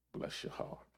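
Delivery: soft breathy whisper of comfort; whispered blessing. Pretend. Jesus comforting the returning sinner, whispered. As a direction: whisper it like a tender mercy.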